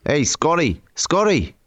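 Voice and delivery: high-pitched Australian shout-out to a mate; mock Aussie calling out. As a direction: imitate an Aussie hailing his pal. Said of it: "What's the typical Aussie name?".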